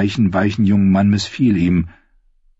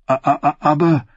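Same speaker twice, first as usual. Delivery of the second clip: higher, halting stammer on the first word; 8 semitones higher than usual. A narrator's reading. Sam stammers to the Archmaester, caught off guard. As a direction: Sam stumbles over his words in shock. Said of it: "stammelte Sam".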